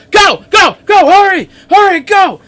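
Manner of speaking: high, full-voiced frantic yelling; urgent shouting. Voices heard up ahead in the hall, urgent shouting. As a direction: shout it like you're rushing people out of danger.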